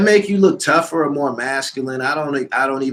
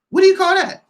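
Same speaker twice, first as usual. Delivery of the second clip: much higher, pressing the question; incredulous high question; 4 dB louder than usual. Real, not acted. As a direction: push the question up in disbelief.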